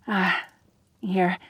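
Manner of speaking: ordinary delivery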